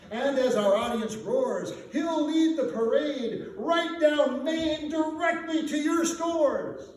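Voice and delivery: booming pitch to the merchants; salesman's big pitch. Acted. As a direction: sell it like a showman promising a parade. McPhee pitches the Santa stunt.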